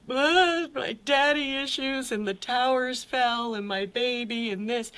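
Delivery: high, whiny self-parody of her excuses; mocking her own excuses. To her counselor at Betty Ford, a mocking high whine. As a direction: whine like a self-pitying patient listing every grievance. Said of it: "I sat there and I".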